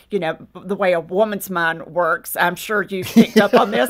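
ordinary delivery

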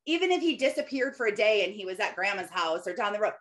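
ordinary talk, nothing marked

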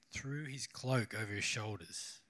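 slow, low and soft, pondering the words; musing repeat. Real, not acted. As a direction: linger quietly over the phrase as if thinking it through. Pauses to ponder the verse again.

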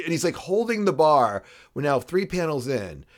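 ordinary talk, nothing marked

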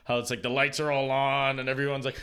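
usual speaking voice

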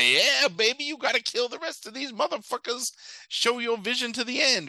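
a lightly put-on British voice, egging him on; British accent. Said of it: "but in a British accent".

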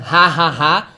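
"Ha, ha, ha" as a spelled-out laugh to show the h; laughing H demo. Shown as a demonstration. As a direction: pronounce "Ha, ha, ha" with an airy h, deliberately. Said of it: "Como você fala ha de rir".

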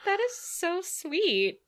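slow, high and touched. Real, not acted. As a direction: melt at the compliment. Touched by cohost's compliment.

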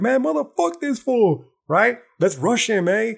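high, slow, hammed-up outrage; mocking blue anon dorks. Online dorks ganging up on the podcaster, high mocking sneer. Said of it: "blue anon dorks like".

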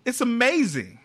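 high, loud gushing; excited raving. Real, not acted. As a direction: rave about it like a superfan. Raving about the show.

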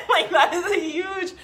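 laughing through the words, pitch jumping up. Real, not acted. Laughing to her viewers that having no offers is a problem. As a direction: crack up at how obvious the problem is.

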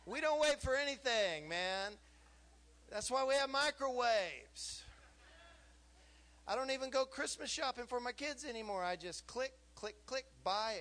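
high, loud, driving preacher voice; preaching at full force. Real, not acted. Preacher rousing the congregation, loud and high. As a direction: build it up and preach it hard.

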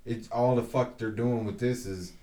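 ordinary talk, nothing marked

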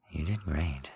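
gentle, low spoken praise, not whispered; soft spoken. Acted. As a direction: say it kindly in a soft voice. Same praise, now softly spoken.

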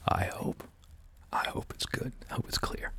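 near-silent breathy whisper. Real, not acted. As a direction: drop to an unvoiced whisper. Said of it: "I can barely hear you right now".

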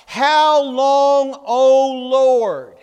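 loud desperate cry to God. A narrator's reading. Preacher voicing Augustine crying out to God. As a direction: cry out to the Lord in anguish. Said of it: "and he cried out".

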